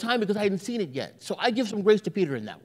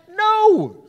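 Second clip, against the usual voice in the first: loud, higher flat refusal; firm dad no; 9 semitones higher, 8 dB louder than usual. Retold or imitated. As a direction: shut the kid down instantly.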